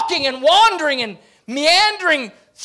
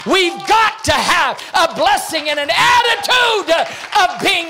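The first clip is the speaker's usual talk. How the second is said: higher, louder, fervent sermon delivery; full-force preaching; 9 semitones higher, 5 dB louder than usual. Acted. Preacher exhorts the church to show mercy, preaching hard. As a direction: drive it home to the congregation.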